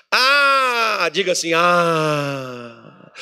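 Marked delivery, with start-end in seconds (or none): 0.1-1.1 a crying, higher, louder "Ah"; lament sigh. Shown as a demonstration. Pastor shows the church how to sigh, crying lament. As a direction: wail it like God lamenting. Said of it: "fala assim com mais sentimento".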